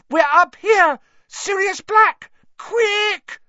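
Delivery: very high, loud scream for help. A narrator's reading. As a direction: scream to be found. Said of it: "Hermione screamed suddenly".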